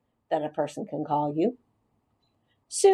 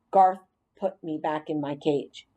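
ordinary delivery, nothing marked

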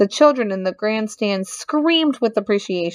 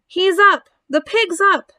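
high, excited crowd cheer; yelling; 10 semitones higher, 4 dB louder than usual. A narrator's reading. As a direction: shout the good news with delight. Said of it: "yelled the crowd".

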